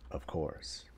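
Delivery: low, very soft aside; muted resignation. Real, not acted. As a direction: say it under your breath. Repeats phrase, first low then emphatic.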